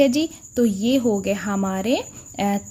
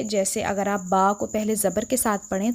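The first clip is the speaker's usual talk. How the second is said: ordinary delivery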